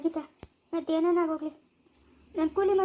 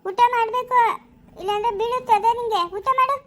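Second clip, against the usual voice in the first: high, loud, scolding caretaker voice; stern nanny coaxing; 7 semitones higher, 5 dB louder than usual. Acted. Orphanage caretaker ordering a crying child to eat, high and loud.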